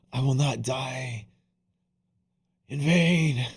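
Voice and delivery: grand mock-epic farewell; mock heroic last words. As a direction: ham it up like a dying movie hero.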